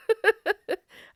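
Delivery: a bright burst of laughter at herself; real laugh. Real, not acted. Laughing at herself about the binned shaver, a real laugh. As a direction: laugh out at your own silliness.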